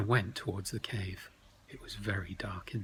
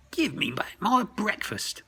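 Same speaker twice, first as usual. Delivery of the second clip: goblin demand; 11 semitones higher, 7 dB louder than usual. Acted.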